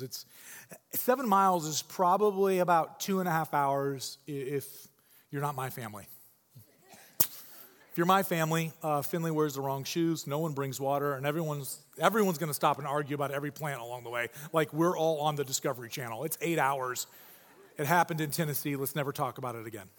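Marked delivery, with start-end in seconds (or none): none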